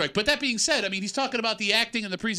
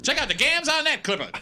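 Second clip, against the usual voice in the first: put-on higher, old-fashioned wiseguy voice; old-timey voice; 7 semitones higher than usual. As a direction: play a nineteen-forties wisecracker. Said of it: "don't talk in an old-timey voice".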